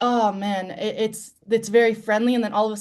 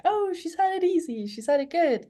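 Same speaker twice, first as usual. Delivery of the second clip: mocking quote; 9 semitones higher than usual. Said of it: "I don't want people to just think".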